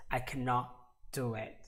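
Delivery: "cannot", a small break, then "do it"; low, careful "cannot", pause, "do it"; pause before DO. Shown as a demonstration. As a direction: demonstrate a gap between "cannot" and "do". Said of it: "place a pause between the two words".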